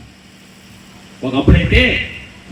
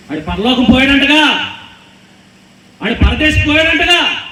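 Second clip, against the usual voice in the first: sneering taunt; 9 semitones higher, 4 dB louder than usual. Acted. Acted mockers sneering about Elijah.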